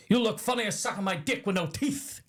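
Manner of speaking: high-pitched mock threat; prison guard impression. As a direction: play the guard's taunt, hammed up. Quoting Hadley's threat.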